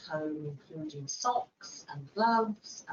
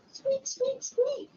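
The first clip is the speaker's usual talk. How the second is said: very high squeaky mouse noises; mouse squeak; 3 dB louder, slower than usual. Shown as a demonstration. Little mouse meeting the lion, very high squeaks.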